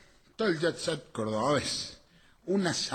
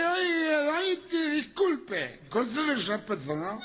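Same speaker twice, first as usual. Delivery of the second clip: put-on drunk apologizing; 9 semitones higher than usual.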